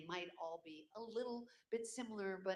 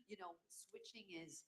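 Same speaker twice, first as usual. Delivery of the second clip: usual speaking voice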